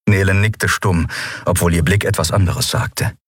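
plain reading voice

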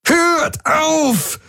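high, desperate roar. A narrator's reading. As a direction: scream him to stop. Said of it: "brüllte Jun". (verzweifelt brüllen)

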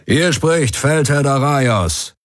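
high, slow proclamation; ringing announcement. A narrator's reading. Commander over ship loudspeakers, slow ringing announcement. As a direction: announce yourself to the whole ship. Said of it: "Seine Stimme erschallte von überall her".

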